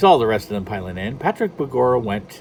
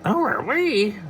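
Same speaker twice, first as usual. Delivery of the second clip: bad Donald Duck; 11 semitones higher, slower than usual. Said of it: "that's more goofy than Donald".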